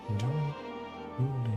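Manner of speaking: ordinary talk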